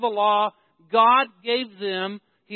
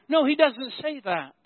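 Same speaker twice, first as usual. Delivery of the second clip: ordinary delivery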